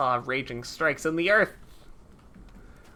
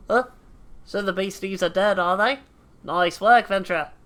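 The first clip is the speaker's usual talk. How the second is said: put-on British accent slipped into Australian; hammy, drifting Australian accent; accidental Australian accent. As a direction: play the game character as an unintended Aussie. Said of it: "why he's Australian now".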